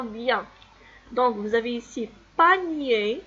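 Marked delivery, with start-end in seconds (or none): 2.4-3.2 "panier" loud and distinct, ending in nié; répétition modèle. Shown as a demonstration. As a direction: model "panier" for repeating. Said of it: "Alors on répète". (« panier » articulé fort, à répéter)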